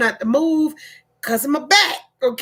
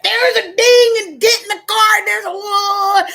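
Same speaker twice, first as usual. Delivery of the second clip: higher, louder whining; whiny husband; 9 semitones higher, 5 dB louder than usual. Retold or imitated. Husband wailing about car damage to wife. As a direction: play the panicked husband.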